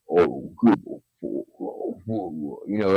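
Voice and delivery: low, quiet demon impression; dropped-low mock demonic sounds. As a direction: imitate a possessed child's deep demon voice. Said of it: "demonic voices".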